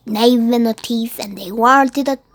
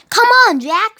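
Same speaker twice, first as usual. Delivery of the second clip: shouting; 9 semitones higher, 6 dB louder than usual. Acted.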